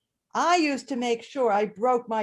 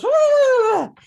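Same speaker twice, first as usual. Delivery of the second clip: excited whoop; 13 semitones higher, 11 dB louder than usual. Retold or imitated. Dog bursts into classroom.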